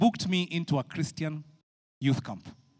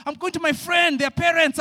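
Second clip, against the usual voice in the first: high, louder pleading excuse; 10 semitones higher, 6 dB louder than usual. Retold or imitated. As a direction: sell the lie to mom. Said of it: "all the lies you can think of".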